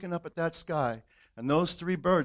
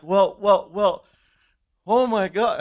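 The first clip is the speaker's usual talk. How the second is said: much louder, higher stammering wonder; overwhelmed exclamation; 3 semitones higher, 8 dB louder than usual. Retold or imitated. Son overwhelmed in front of his mom, much louder. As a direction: burst out, lost for words.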